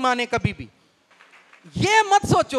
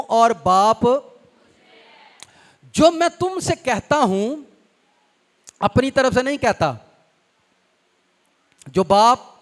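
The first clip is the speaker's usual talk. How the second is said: preaching at full force; 6 dB louder than usual. Acted. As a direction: drive each phrase home to the congregation. Loud preaching reading scripture.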